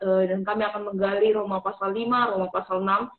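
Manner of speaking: earnest spoken prayer. Acted. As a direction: pray aloud, sincere and measured. Opening prayer for the session. (doa yang khusyuk)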